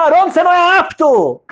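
high, loud, accusing shout; angry accusation. Retold or imitated. Korach yelling at Aaron that he is unfit. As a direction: hurl the charge at Aaron.

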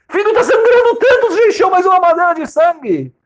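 alarmed father. Acted. Father horrified his son bled into the bottle, high alarmed. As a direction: cry out in horror at the blood.

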